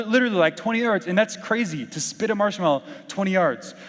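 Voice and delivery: normal talking voice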